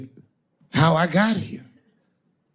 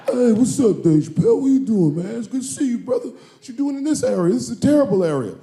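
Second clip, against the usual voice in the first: higher, louder, hammed-up bodyguard voice; loud goon greeting; 7 semitones higher, 4 dB louder than usual. Goon at the dressing room door greets Dave, loud and high. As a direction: play the big friendly doorman.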